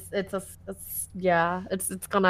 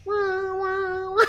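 mock baby cry; 11 semitones higher, slower than usual.

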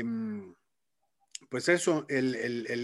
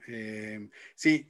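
usual speaking voice

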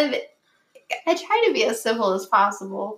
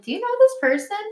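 much higher, pointed and loaded; pointed question; 11 semitones higher than usual. Retold or imitated. To a friend about a creep, pointed high question. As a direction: ask it like you already know. Retells messaging her friend.

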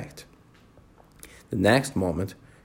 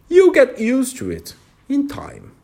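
high, loud creature voice; high caterpillar voice; 13 semitones higher, 5 dB louder than usual. A narrator's reading. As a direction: say it high and superior. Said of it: "said the caterpillar".

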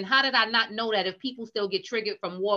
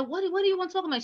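anxious quote; 9 semitones higher, 3 dB quieter, much faster than usual. Retold or imitated. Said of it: "And I said".

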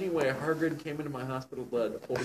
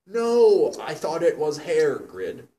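mock dramatic outburst; 4 semitones higher, 12 dB louder, much slower than usual. Reading dramatic fanfic dialogue aloud.

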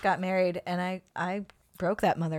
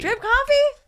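incredulous; 6 dB louder, slower than usual. Real, not acted.

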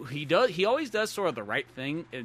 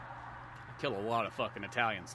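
quiet menace; 4 semitones lower, 8 dB quieter than usual. Retold or imitated. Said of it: "And he's like".

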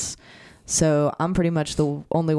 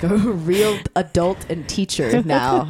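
slow and higher, laughing through it; 4 semitones higher, 3 dB louder, slower than usual. Real, not acted. Laughing about being a real teacher now. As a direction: laugh at the idea of being a grown-up.

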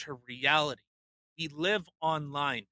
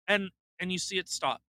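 ordinary delivery

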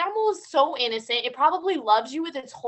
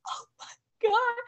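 teary mock wail; 11 semitones higher, 4 dB quieter than usual. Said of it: "And I'm just like".

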